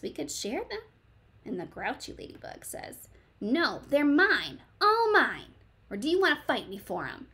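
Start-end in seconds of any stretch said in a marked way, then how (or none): 0.0-0.8 high, soft sweet offer; sweet friendly voice. Acted. Friendly ladybug offering to share. 3.4-7.3 grouchy refusal. Acted. Grouchy ladybug refusing to share.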